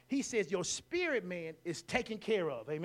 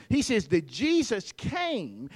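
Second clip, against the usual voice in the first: ordinary delivery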